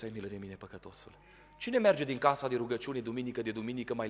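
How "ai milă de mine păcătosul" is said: much softer and lower, almost breathed out; hushed plea. Retold or imitated. Preacher repeats the tax collector's prayer, hushed. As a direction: sink into a humble murmur.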